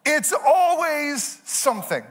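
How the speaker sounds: drawn-out, slow, high pitched exasperation; weary comic groan of a line; exasperated. Pretend. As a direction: stretch it out like you've had enough. Exasperated punchline of the refrain.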